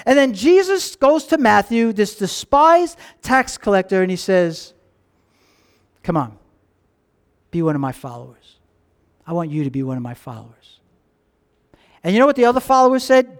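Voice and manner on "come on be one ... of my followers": soft, low, tender invitation; gentle invitation. Acted. Jesus softly inviting the despised tax collector, tender. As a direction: draw him in softly, like a friend.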